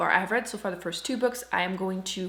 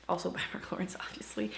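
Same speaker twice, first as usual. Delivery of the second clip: whispered aside; 2 semitones lower, 10 dB quieter than usual. Real, not acted. Whispered aside about the author.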